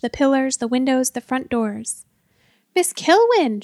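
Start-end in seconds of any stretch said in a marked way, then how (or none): 2.7-3.6 high, loud shriek of a name; panicked shriek. A narrator's reading. Man calling his colleague as a dragon looms, panicked shriek. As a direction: shriek for help as the character. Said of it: "shrieked Hackenpfeffer again".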